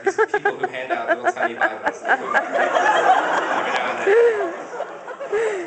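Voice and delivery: high, loud, slow sing-song mockery; mocking high voice. Comic mocking people handing out tiny Bibles. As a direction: send up the Bible handers.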